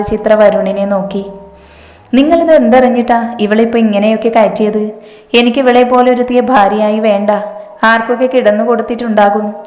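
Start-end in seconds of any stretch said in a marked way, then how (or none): none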